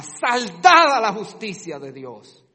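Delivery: Christ's blood declaring justice paid, loud and triumphant; triumphant cry. Acted. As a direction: announce victory. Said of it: "La sangre de Cristo clama".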